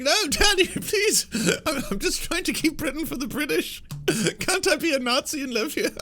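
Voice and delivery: very high and loud, falsetto begging; pleading son. Retold or imitated. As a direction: beg like a whiny little boy. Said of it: "He's like".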